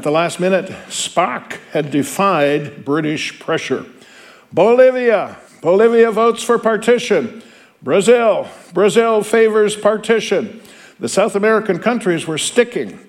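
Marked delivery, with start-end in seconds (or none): 4.5-7.4 roll call announcer. Acted. Teacher reading the roll call to students, called out higher. 7.8-10.6 calling the nation out higher, slow; roll call announcer. Acted. Teacher reading the roll call to students, called out higher.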